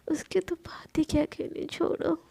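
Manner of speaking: soft bashful whisper; shy secret whisper. Acted. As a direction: whisper it to herself. Bashful thought about her love.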